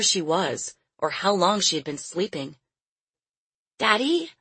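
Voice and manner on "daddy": put-on high, questioning little girl's call; weak child's cry. A narrator's reading. Lost girl waking in a cage calls her father, weak cry. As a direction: call out small and high for Daddy. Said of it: "she cried in a weak voice".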